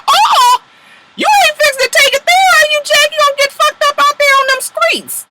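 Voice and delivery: high, loud shrill-pitched warning, way up; mock warning. Host talking to Jackie on screen, high loud mock warning. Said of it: "I said".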